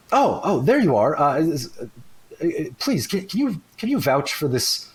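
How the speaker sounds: flustered character voice. Acted. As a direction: stammer out an anxious plea for someone to vouch. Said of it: "you hear a voice going".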